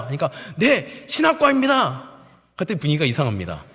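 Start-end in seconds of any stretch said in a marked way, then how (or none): 0.6-2.1 loud, high, drawn-out military reply; recruit's answer. Retold or imitated. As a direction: sound off slowly like a new private. Recruit reports his major.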